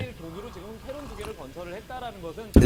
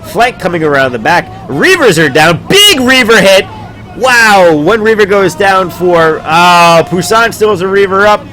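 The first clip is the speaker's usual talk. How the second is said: excited; 7 semitones higher, 7 dB louder than usual. Real, not acted. Big fight, excited play-by-play.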